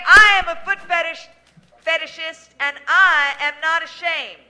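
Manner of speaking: loud, high proud declaration; shouted affirmation. Shown as a demonstration. Host leading caller to proclaim it, loud and proud. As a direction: bellow it out like a vow.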